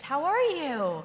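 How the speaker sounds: "How are you?" stretched out slowly; slow drawn-out greeting. Retold or imitated. Colleague's slow drawn-out overnight greeting. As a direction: drag the question out lazily. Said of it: "this was my lead to the conversations".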